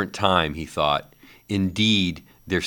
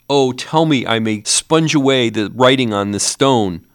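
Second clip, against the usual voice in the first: higher, louder desperate promise; agonized vow; 6 semitones higher, 7 dB louder than usual. A narrator's reading. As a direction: swear it in anguish. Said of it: "In his agony".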